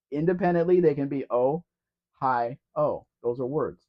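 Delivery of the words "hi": normal talking voice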